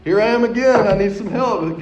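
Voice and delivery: loud, high cry to the Lord; desperate plea. Retold or imitated. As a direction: call upward for help, hammed-up exasperation. Acted desperate prayer in retold story.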